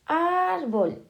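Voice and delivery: ÁRbol, with a long drawn-out ÁR; stress on first syllable. Shown as a demonstration. Teacher showing a llana word, árbol stressed on ÁR. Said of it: "en la penúltima".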